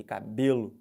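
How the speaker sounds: "cabelo" with a loud, exaggerated BE; forced middle-syllable stress. Shown as a demonstration. Teacher urges forcing the tonic syllable, loud stress on BE. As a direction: force "be" in "cabelo" as hard as you like. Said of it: "Com muita força".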